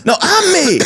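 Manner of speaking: very high-pitched comic movie impression; Chris Tucker. Re-enacting a film scene for co-host, squeaky high impression. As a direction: squeal it in a confused comic outburst. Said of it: "That scene where he's like".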